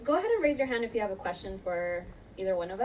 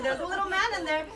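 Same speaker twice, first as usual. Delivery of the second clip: high, sing-song tease about a little man; playful teasing voice; 9 semitones higher, 4 dB louder than usual. Retold or imitated. Bride teasing her single bridesmaids, playful high voice. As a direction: pitch it way up like a secret surprise.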